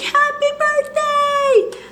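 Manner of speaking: high, bright "Happy birthday!" cheer; excited cheer. Retold or imitated. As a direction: call it out like a surprise party crowd. Friends shouting surprise greeting.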